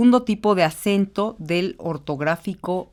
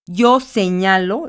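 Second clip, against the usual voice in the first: Yo señalo slow and loud on ÑA; slow teacher demo of señalo, stress on the middle syllable; 5 dB louder, slower than usual. Shown as a demonstration. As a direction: lean on ña in señalo. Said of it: "la palabra no es aguda".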